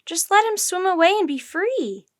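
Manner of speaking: high, loud, earnest plea; urgent high-pitched appeal; pleading. Acted. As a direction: beg for the creature's freedom. Jack keeps pleading.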